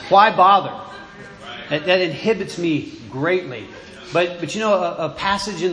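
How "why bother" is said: rising frustration, louder, breaking into a laugh; exasperated. Retold or imitated. Preacher venting about his unfruitful work, exasperated. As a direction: throw up your hands, half laughing.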